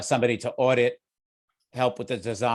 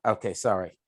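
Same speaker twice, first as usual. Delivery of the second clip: normal talking voice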